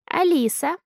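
put-on high little-girl voice, proud rising answer; proud child. A narrator's reading. Daughter tells the man her name, proudly. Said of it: "гордо отвечает моя дочь".

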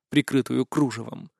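ordinary delivery

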